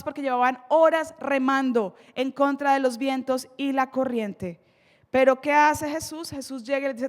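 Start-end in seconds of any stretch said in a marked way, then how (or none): none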